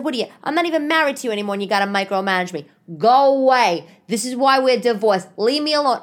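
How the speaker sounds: rapid rant building to a loud dismissal; angry outburst. Retold or imitated. Ex-wife sick of being micromanaged, angrily retold.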